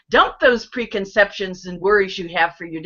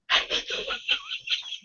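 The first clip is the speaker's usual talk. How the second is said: silent laughter; 12 dB quieter than usual. Shown as a demonstration. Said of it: "as silent laughter. So, just like me".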